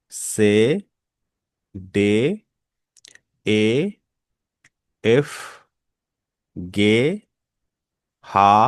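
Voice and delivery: German letters from আ to সেট, each said on its own; German alphabet read through. Shown as a demonstration. Teacher rereading the alphabet for students, each letter clearly. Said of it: "আমি আবারও পড়ছি, ভালো করে লক্ষ্য করুন".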